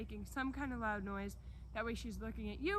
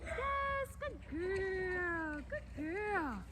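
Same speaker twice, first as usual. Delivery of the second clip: much higher, drawn-out praise to a dog; 7 semitones higher, much slower than usual. Real, not acted. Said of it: "kinda like baby voice".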